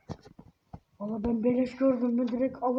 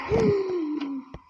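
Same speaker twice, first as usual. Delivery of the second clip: startled gasp; 6 semitones higher, 4 dB louder than usual. Real, not acted. Sudden scare in the game.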